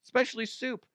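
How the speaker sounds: high, mock-amused voice of a jobless viewer; high goofy impression of someone laughing at dumb videos; mocking unemployed viewer. Jobless viewer mocking himself at home, high goofy voice. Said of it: "And then they're like".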